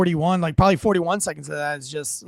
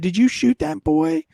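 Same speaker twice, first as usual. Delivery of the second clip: higher-pitched retold mom; mom's anxious question; 10 semitones higher than usual. Son retelling his mom's first words after the fight, high-pitched questioning. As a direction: lightly imitate a mother's high questioning voice.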